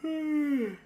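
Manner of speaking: loud sigh, pitch way up; loud high sigh. Real, not acted. A loud sigh at the screen, then apologizing. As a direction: let out a big high sigh. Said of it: "Apologies for that".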